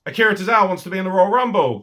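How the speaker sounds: ordinary talk, nothing marked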